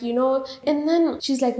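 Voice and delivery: normal talking voice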